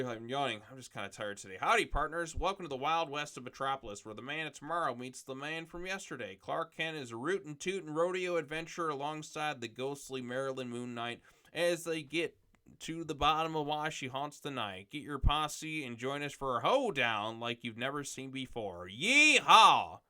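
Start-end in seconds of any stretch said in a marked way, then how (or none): 1.6-18.9 hammed-up rodeo cowboy, higher and louder; terrible cowboy voice. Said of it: "You love my terrible cowboy voice?". 18.9-20.0 whooping yeehaw, much louder and higher; a big hammed-up cowboy whoop. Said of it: "You love my terrible cowboy voice?".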